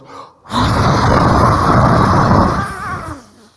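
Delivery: long loud gasping inhale, imitating; deep gasping breath. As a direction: mimic a man sucking in breath. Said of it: "and he goes".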